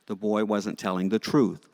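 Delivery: ordinary talk, nothing marked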